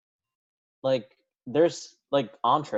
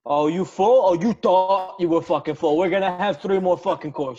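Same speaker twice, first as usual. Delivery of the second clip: pushy Italian host voice; Italian mobster accent; 4 semitones higher than usual. Pretend. Mock Italian host bit.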